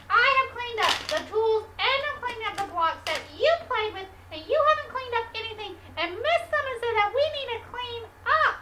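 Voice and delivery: ordinary talk, nothing marked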